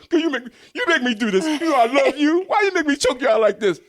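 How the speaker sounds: high, loud hammed-up abuser voice with a laugh; playing a choking boyfriend who swears love, loud and high; mock pleading abuser. Acting abuser choking girlfriend.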